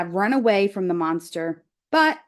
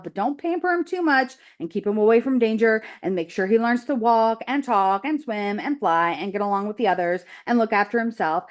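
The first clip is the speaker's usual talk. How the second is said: brisk goose rattling off list; faster than usual. Acted. Goose lists motherhood duties.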